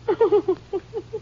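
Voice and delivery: high, brittle nervous laugh. Acted. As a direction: laugh on the edge of breaking. Confronted wife breaks down.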